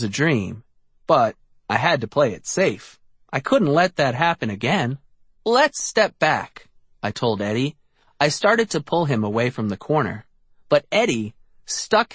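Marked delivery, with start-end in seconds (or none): 5.5-6.7 slow, higher cautious warning. A narrator's reading. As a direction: ease him back carefully. Artie cautious at the corner.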